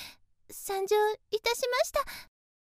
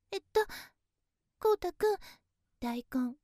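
ordinary talk

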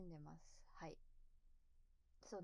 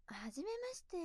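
high, put-on sweet greeting; cutesy greeting voice; 11 semitones higher than usual. Shown as a demonstration. Streamer showing viewers her usual self-introduction, cutesy put-on voice. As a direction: play up a cute, proper hello. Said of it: "可愛こぶってるかもしれない".

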